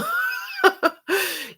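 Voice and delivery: short high laugh; high giggle. Real, not acted. Laughs after apologizing for sarcasm.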